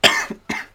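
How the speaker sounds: sudden spluttering cough; choking cough. Real, not acted. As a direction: choke on a drink. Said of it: "I was just choking".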